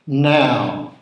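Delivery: a mild roar of command. A narrator's reading. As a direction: bark the signal to attack. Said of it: "roared Lucifer".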